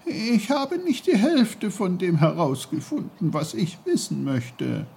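put-on high tearful boyish protest; plead, voice close to breaking; almost crying. A narrator's reading. Peter, near tears, protesting he has not learned enough. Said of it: "Peter weinte fast".

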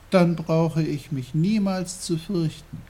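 put-on high little-boy voice; high, childlike realisation; relieved boy Peter. A narrator's reading. Peter realises he need never be afraid, high.

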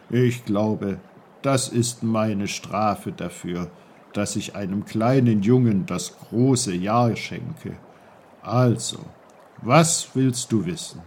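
lightly voiced patient old uncle; low, patient old uncle. Uncle answers Peter.

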